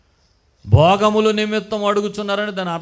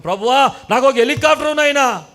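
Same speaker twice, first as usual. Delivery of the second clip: higher, louder mock plea; lazy man begging God, hammed-up and louder; mock begging prayer; 5 semitones higher, 5 dB louder than usual. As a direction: whine to God for a helicopter. Mocks a man begging for helicopter.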